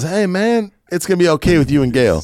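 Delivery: reassuring stranger. Retold or imitated. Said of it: "He goes".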